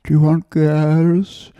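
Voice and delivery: high, slow put-on old-woman voice; old woman procurer. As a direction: draw out the offer like a caricatured old madam. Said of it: "ugly old women offering you prostitutes".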